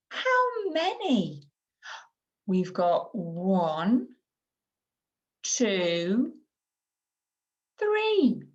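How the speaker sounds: put-on slow, sing-song counting for a small child; modelling language. Shown as a demonstration. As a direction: count out with long vowels, swooping high on three. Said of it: "we might say something like".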